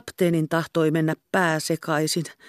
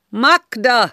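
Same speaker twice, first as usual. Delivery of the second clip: loud, high mass shout; hundred men shouting; 9 semitones higher, 7 dB louder than usual. A narrator's reading. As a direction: bellow like a crowd. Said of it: "kuin sadan miehen suusta".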